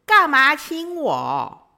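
high, slow, loud mock-angry protest; pretend anger. To boyfriend who kissed her, loud pretend anger. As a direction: fake being offended. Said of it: "我就假装生气的说".

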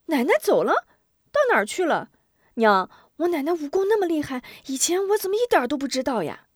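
put-on high, loud, fast child questions; puzzled boy asking his mother, surprised. A narrator's reading.

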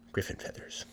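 soft puzzled whisper; whispered to himself. Acted. Hans alone in the woods, puzzling it out: whispered. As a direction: breathe the words to yourself, wondering.

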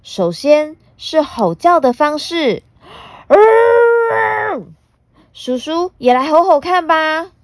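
put-on high, bright little girl. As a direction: chatter like a cheeky young girl. Puna teaches roaring.

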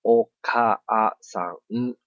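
お母さん said very slowly, か stretched; slow Japanese demo. Shown as a demonstration. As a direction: drag out お母さん syllable by syllable. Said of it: "我们讲お母さん的时候".

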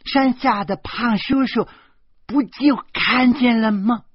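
put-on high, loud little girl's voice; little girl butting in. Little girl correcting the adults, in a high loud child's voice. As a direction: pipe up like a cheeky small girl. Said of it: "指着烽火台的楼梯说".

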